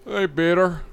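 lightly voiced Joe impression; a hint of the cartoon neighbor; Joe Swanson voice. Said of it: "do a really good Joe".

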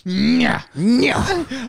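taunting. Acted. Mocking taunt.

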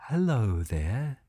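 slow, soft and gentle greeting; very calmly. Acted. Old cow Lacey greeting the scared baby unicorn, very calm. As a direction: reassure a frightened baby. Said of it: "said Lacey very calmly".

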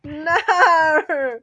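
loud, high, hammed-up Aussie "no"; exaggerated Australian cry; Australian accent. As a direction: wail it in a broad Australian accent. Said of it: "jump into an accent".

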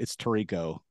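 Tirico stressed on the middle, tuh-REE-koh; corrected pronunciation. Shown as a demonstration. Correcting the cohost's slip, Tirico as tuh-REE-koh. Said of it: "he's saying that wrong".

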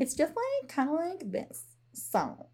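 put-on valley girl, breezy and airy. Shown as a demonstration. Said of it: "in my super Valley Girl voice".

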